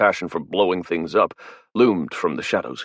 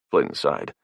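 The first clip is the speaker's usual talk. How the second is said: usual speaking voice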